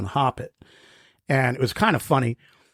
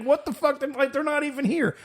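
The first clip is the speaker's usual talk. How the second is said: confused outrage; 12 semitones higher, 3 dB quieter than usual. Retold or imitated. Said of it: "He's like".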